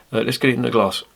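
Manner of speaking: ordinary delivery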